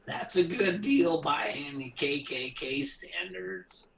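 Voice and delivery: slow, high, soft character voice; joking put-on voice. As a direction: draw it out in a mock voice. Repeats it in put-on voice.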